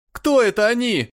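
high, loud frightened cry; alarmed shout. A narrator's reading. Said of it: "закричал Билли, снова встревожась".

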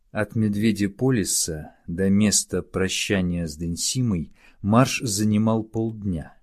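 plain reading voice

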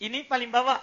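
ordinary talk, nothing marked

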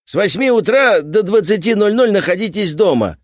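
loud, high bossy workman voice; commanding repairman. Acted. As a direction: order it like a pushy repairman. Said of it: "велел незнакомец".

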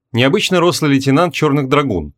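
plain reading voice

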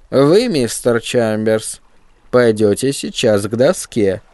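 a hint of a creaky, nasty schoolteacher; lightly voiced mean old teacher, slow and creaky. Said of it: "противно скрипучим голосом".

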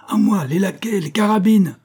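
high, loud rallying shout; calling for help. A narrator's reading. Steward summons lackeys with carbines, shouting. Intendant calls for servants and guns.

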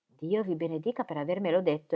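ordinary delivery

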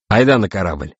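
mildly decisive summons; firm, brisk-minded order. A narrator's reading. Navigator orders both captains to the ship, decisive. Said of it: "решительно сказал Кошкин".

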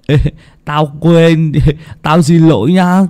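put-on high, loud boyish voice with a laugh; a chubby village kid apologizing, laughing it off; sheepish boy. Hưng apologizing to Tèo for shouting, high sheepish boyish voice.

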